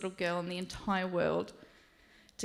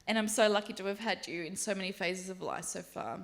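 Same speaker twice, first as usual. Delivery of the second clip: ordinary delivery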